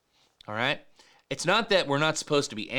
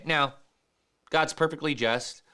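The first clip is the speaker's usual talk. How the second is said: usual speaking voice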